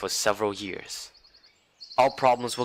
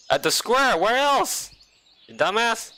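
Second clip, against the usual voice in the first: higher, scolding camp leader; exasperated camp leader; 10 semitones higher than usual. Acted. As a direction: talk down to him, fed up.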